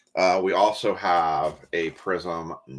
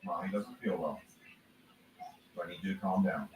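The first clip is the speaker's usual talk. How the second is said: soft soothing; 12 dB quieter than usual. Real, not acted. Soothing son, away from mic.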